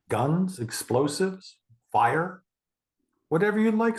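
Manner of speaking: high, sing-song indulgent offer; coaxing parent. Shown as a demonstration. Parent coaxing a child in therapy role-play, sing-song.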